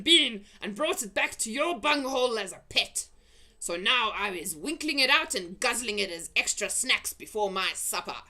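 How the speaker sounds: loud, high greedy threat; menacing giant. Acted. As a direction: gloat over the snack to come.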